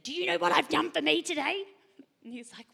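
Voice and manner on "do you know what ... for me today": high, loud, fast mock snap; hammed-up exasperated mum; retold snap at son. Mum retelling how she snapped at her son, mock angry. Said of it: "I just said".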